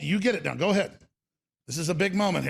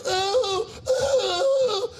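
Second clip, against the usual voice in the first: mocking socialites. Mocking New York elites at dinner, swooning oh. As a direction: ham up a gushing socialite. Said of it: "they go".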